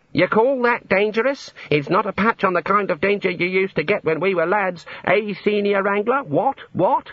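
mock an old boy reminiscing to his chum; old wizard's boasting. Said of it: "They say things like".

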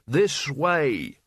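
mildly bellowed order. A narrator's reading. Albert summons the two over roaring sand, bellowing. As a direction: call them over above the din. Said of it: "bellowed Albert above the roar of sand".

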